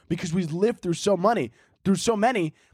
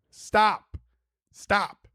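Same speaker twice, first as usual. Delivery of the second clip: normal talking voice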